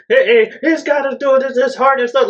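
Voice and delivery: imitating an angry comedian, high-pitched; angry comic rant. Retelling an angry comedian's steroid bit, worked-up rant. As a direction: rant like an exasperated stand-up. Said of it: "he was the angry dude".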